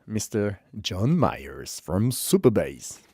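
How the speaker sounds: slow, drawn-out announcer intro; showy name announcement. Real, not acted. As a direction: stretch the guest's name out grandly. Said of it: "why do I say it like that".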